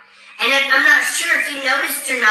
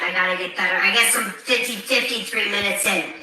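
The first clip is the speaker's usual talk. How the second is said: usual speaking voice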